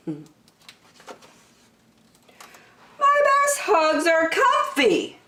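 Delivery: put-on high, slow childlike musing; dreamy high bear voice; drawn-out high wish; Douglas's voice.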